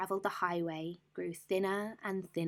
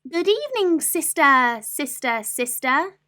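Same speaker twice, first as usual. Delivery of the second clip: high, loud duck call; quacking duck; 9 semitones higher, 10 dB louder than usual. Acted. Duck calling her sister at the cave, loud quack. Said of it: "She quacked".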